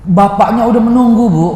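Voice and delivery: normal talking voice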